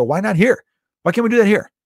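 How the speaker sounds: a fed-up why-not-here protest; exasperated outburst. Retold or imitated. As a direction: call it out like it's obvious. Retelling his own indignant reply.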